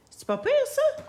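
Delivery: very high and proud. Real, not acted. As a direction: show it off in a high voice. Showing off finished card. (voix aiguë et fière)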